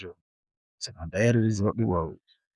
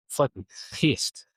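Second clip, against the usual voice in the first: slow, seething retelling; pissed off; 3 semitones higher, slower than usual. Retold or imitated. Kid in fifth grade mad at the teacher, pissed off. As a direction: draw it out, fed up and mad. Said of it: "and I'm mad".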